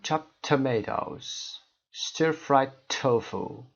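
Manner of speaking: chopped tomatoes, stir fried tofu slow, joined; slow merged word pairs; dropped sound demo. Shown as a demonstration. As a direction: let the final sound vanish. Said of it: "دیگه نمیشنوید".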